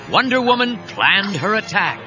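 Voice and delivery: usual speaking voice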